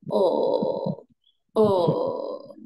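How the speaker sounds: o pushed low from the throat; low third tone from the throat. Shown as a demonstration. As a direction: dip o down into the throat. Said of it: "keluarnya di, eh, tenggorokan".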